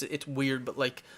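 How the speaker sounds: normal talking voice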